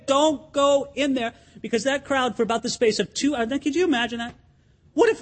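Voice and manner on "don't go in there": slow, pleading, drawn out; slow begging. Retold or imitated. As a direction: beg him slowly. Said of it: "They have to beg him".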